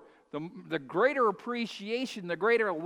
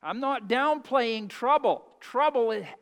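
usual speaking voice

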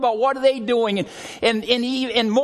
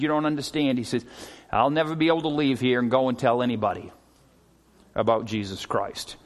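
subdued tearful man; 8 semitones lower, 4 dB quieter than usual. Retold or imitated. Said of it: "he starts crying".

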